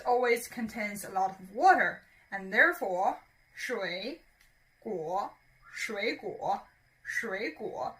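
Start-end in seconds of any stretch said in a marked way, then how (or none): 3.6-7.9 水 and 果 both in a low dipping third tone; slow dipping third tones on 水果. Shown as a demonstration. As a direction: let the voice sink low, then lift a little on 水果. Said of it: "Both of them are third tone".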